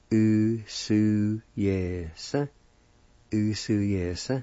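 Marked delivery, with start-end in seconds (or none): 0.1-2.3 "U, su, yes" in drawn syllables, briefly separated. Shown as a demonstration. Teacher repeats word for picked to learners, syllable by syllable. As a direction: repeat "U, su, yes" carefully, part by part. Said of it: "like this".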